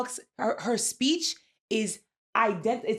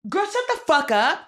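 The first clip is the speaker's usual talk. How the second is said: exasperated outburst; 9 semitones higher, 7 dB louder than usual. Real, not acted.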